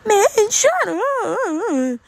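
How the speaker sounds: very high, slow, squeaky cracking voice; exaggerated voice crack. Imitating the annoying girl telling him to shut up, voice cracking. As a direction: ham up her voice breaking mid-word. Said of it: "she has a voice crack every two seconds".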